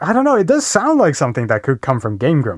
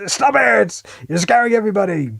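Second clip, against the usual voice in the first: mock scolding yell; 8 semitones higher than usual. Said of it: "You're screaming in real life".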